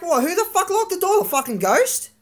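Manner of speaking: high, incredulous retort; acted indignant sarcasm; retold exasperated disbelief. Retelling argument over locked door.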